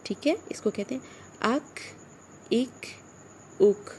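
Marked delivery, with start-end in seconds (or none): 1.6-3.9 "اک" ending on a k with breath, no bounce; short "اک", breath released after k; kaf without echo. Shown as a demonstration. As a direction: close "اک" with an airy k and no added vowel. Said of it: "اس کی آواز پلٹے گی نہیں".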